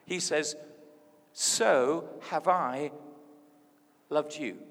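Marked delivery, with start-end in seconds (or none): 1.3-4.6 very slow, starting loud on "So"; deliberate, spaced-out declaration; emphatic slow quotation. Acted. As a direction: spread the words out with a weighty pause. Jesus quoted with weighty emphasis.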